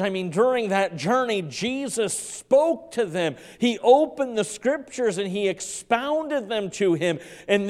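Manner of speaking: rising, loud preaching to the congregation; preacher pouring it out to the church, loud and rising; full-force preaching. Acted. Pastor stirring the congregation, loud full-force preaching.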